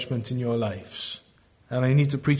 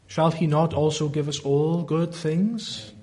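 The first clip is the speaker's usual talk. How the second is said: ordinary delivery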